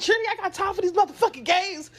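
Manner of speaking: high, heated mock scolding; exasperated mom. Mom fed up with her kid's guessing game, exasperated. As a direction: imitate a mother losing patience. Imitates mother's exasperated outburst.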